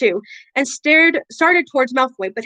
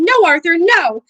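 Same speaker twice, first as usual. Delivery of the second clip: high, loud pleading shriek; a mother shrieking at her husband to stop; 5 semitones higher, 5 dB louder than usual. Acted. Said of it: "Missus Weasley shrieking".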